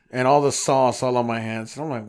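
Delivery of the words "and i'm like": ordinary delivery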